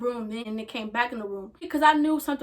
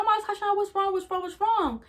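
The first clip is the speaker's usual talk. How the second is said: alarmed friend; 9 semitones higher than usual. Retold or imitated. Worried friend on FaceTime.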